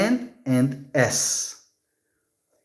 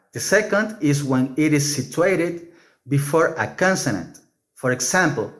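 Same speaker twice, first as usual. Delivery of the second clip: ordinary delivery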